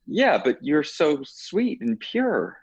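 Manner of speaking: high, slow mock sweetness; mocking sweet voice. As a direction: coo it like a doting fan. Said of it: "And they're like".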